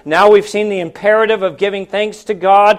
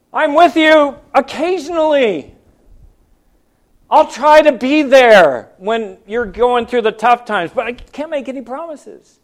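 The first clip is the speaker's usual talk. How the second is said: sarcastic, watered-down promise in a put-on voice; mock misquote; 4 semitones higher than usual. Preacher misquoting Jesus to the church to mock a weak promise, sarcastic. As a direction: toss it off like a flaky friend who won't commit. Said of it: "What did he say?".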